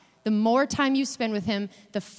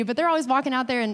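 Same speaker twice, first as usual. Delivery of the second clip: ordinary delivery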